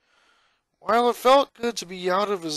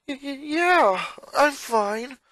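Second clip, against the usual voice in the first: put-on high, shaken, stammering boy; hesitant stammer; 11 semitones higher, 4 dB louder, slower than usual. Boy just saved from a gator answering his sister, stammering. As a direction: stutter it out, still in shock. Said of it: "Lincoln replied hesitantly".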